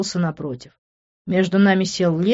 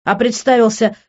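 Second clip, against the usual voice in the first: high, emphatic, biting taunt; mocking, raised-pitch question; venomous; 6 semitones higher, 5 dB louder than usual. A narrator's reading. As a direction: needle them with poisonous sarcasm. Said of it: "ядовито спросил Тео".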